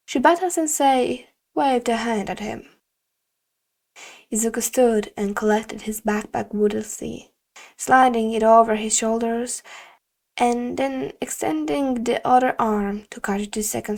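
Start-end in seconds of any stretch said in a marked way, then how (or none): none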